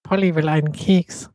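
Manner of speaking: hohe, fremd klingende Papageienstimme. Pretend. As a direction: plappere wie ein zahmer Papagei. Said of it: "Das klang wohl eher nach Papagei". (Papagei, der nach einem Keks krächzt)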